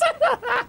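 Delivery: laughing high; high squeaky laugh. Real, not acted.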